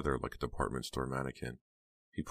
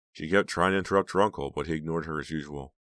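usual speaking voice

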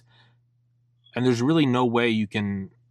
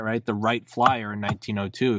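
usual speaking voice

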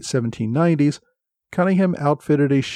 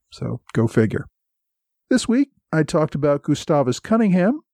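ordinary talk

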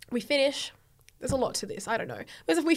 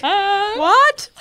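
confused dad; 8 semitones higher, 11 dB louder than usual. Retold or imitated. Dad's confused reply.

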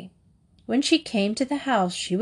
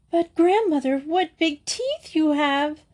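growing astonishment; 7 semitones higher than usual. A narrator's reading. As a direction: marvel in rising alarm, stretching the words. Girl amazed at disguised wolf.

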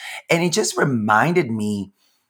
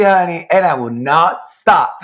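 usual speaking voice

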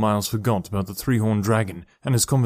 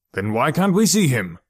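frustrated cry; 4 semitones higher, 4 dB louder than usual. Acted. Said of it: "Dad cried".

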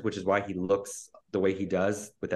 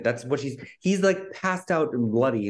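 ordinary talk

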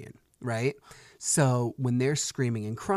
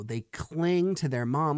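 usual speaking voice

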